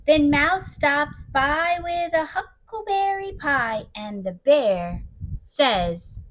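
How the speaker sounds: unhurried, hushed storytelling; story narration. A narrator's reading. Storyteller describing lonely Bear in his cave, slow gentle narration. Reads the picture book aloud.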